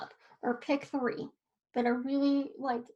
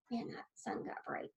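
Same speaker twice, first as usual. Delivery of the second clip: muttered aside; 2 semitones lower, 12 dB quieter than usual. Real, not acted. Aside muttered about bright sun.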